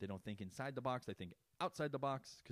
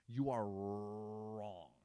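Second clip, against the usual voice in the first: slow taunt; much slower than usual. Pretend. Drawn-out taunt.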